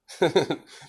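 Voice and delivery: high, light mock laugh of a proud mother; a lightly acted motherly chuckle; mother's laugh. Mother laughs at her son's boast, a high mock chuckle. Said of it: "She's laughing".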